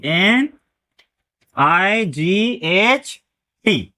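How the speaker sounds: very slow letters with pauses between; one letter at a time, widely spaced; spelled out slowly. Shown as a demonstration. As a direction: draw out each letter of एन आई जी एच टी. Teacher respells it letter by letter.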